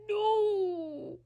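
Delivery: high, loud wail of denial; loud high pained no; anguished no. Real, not acted. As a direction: cry out no as if wounded.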